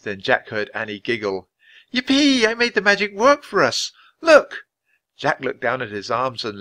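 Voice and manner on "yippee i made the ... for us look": high, loud cheer of triumph; girl thrilled her magic worked; excited cheer. Acted. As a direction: burst out with glee. Spell works, Annie cheers.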